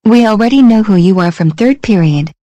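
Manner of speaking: furious yelling at classmates; angry shouting. Acted. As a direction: scream it with rage. Girl yells at new classmates.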